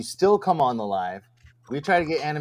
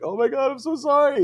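much higher, panicky mock apology; scared apology; 13 semitones higher, 3 dB louder than usual. Apologizing to the caller she feared was mad, high scared mock voice. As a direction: squeak out a frantic sorry. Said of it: "I was all scared".

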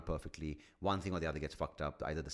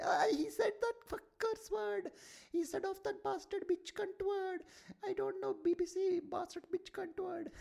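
much higher, offended Indian accent mock rant; Indian accent caricature. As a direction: whine in a prudish Indian accent about swear words. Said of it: "And they're like".

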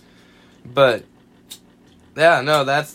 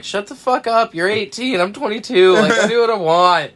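higher, louder mock-angry comeback; drunken snap; 8 semitones higher, 6 dB louder than usual. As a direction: brush her off like a cocky drunk. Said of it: "And I'm like".

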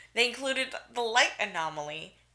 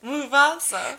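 louder, higher laughing call; laughing Mufasa; 7 semitones higher, 7 dB louder, slower than usual. Real, not acted. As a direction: giggle the name as the bit peaks. Mufasa call-and-response bit.

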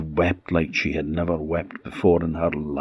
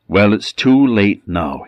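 cold rebuke; 3 semitones higher, 7 dB louder than usual. Acted. Minister refuses to forgive wife.

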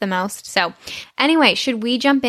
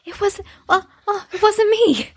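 higher, flustered student denying blame; caught pupil; 12 semitones higher than usual. Retold or imitated. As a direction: squeak out a guilty denial.

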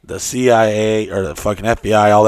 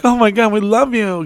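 very high, gushing squeal; imitated gushing girls; 13 semitones higher than usual. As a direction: mimic adoring girls in a high voice. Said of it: "And they were like".

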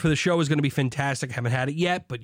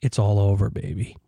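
low growl-like gloat; 10 semitones lower than usual. Acted.

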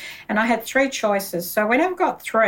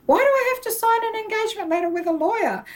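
much higher, fast baffled question; incredulous; 12 semitones higher, faster than usual. Retold or imitated. Thinking about the lawyer's letter, fast high incredulity. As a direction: rush the disbelief out.

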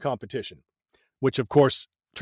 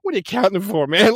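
mild annoyed quote; 5 semitones higher, 3 dB louder, faster than usual. Retold or imitated. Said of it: "like you said".

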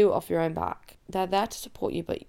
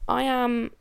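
usual speaking voice

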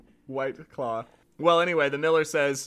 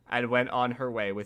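plain reading voice

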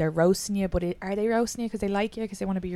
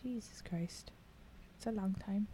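whispered, awed disbelief; hushed amazement; 3 semitones higher, 16 dB quieter than usual. Real, not acted. Friend hearing she's been together nine years, whispered awe. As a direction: breathe it out in wonder.

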